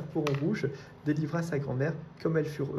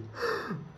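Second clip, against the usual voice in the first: high, sharp startled gasp; 5 dB quieter than usual. A narrator's reading. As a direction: gasp in fright as the wolf pounces. Le loup se jette sur elle. (petite fille qui sursaute de peur quand le loup bondit)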